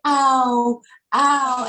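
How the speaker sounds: put-on child's ow. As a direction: mimic the little boy crying out in pain. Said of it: "So he was actually saying".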